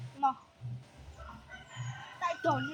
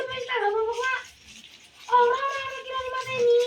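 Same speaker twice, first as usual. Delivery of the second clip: raised, high-pitched objection; loud protest; 6 semitones higher, 5 dB louder, faster than usual. Real, not acted. Protesting during live gameplay.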